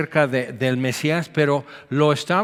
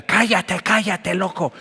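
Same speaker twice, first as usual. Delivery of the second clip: hushing snap; 4 semitones higher than usual. Acted. Crowd silencing the beggar.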